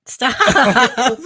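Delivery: loud, drawn-out plea through laughter; laughing protest. Real, not acted. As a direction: beg off loudly, half laughing. Protests when handed the sad poem.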